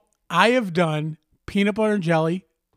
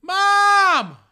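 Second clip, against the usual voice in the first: much higher, loud whiny call; kid calling mom; 8 dB louder than usual. Acted. Kid yelling to mom for a sandwich, whiny. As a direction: yell for mom like a needy kid.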